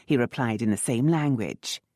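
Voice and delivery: plain reading voice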